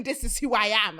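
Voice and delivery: loud imitation of a confident caller; mock self-assured. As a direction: declare it loudly, playing the confident caller. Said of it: "it was given".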